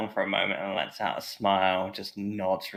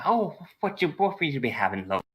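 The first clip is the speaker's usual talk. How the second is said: lightly voiced friendly barmaid, a bit higher; 7 semitones higher than usual. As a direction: play the tavern barmaid, welcoming.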